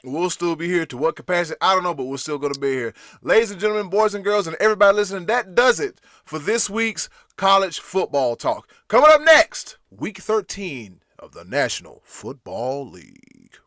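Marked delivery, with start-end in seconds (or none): 3.2-13.6 loud, high announcer voice, ladies and gentlemen; podcast host wrapping up the episode in announcer style; show sign-off. Acted. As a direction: boom it out like a radio host closing the segment.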